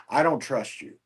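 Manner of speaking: ordinary talk, nothing marked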